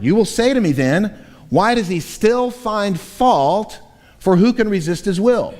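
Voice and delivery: a touch of sarcastic, adversarial challenge; argumentative opponent. Retold or imitated. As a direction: throw the objection back, demanding. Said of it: "the tone of voice of Paul's opponent".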